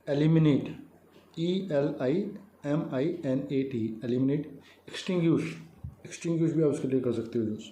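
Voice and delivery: normal talking voice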